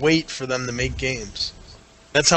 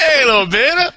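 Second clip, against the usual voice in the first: high, louder hello to someone named Beta; mock greeting; 11 semitones higher, 4 dB louder than usual. Co-host saying hello to whoever Beta is, mock greeting. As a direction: hail Beta with a laughing, hammed-up call. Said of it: "Sounds like you're saying hello to whoever Beta is".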